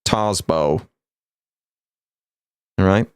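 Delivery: imitated accent, TARZ-boh; listener's accent. Shown as a demonstration. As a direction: say Tarsbo with a long AR, imitating their accent. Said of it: "I'll say it in your accent".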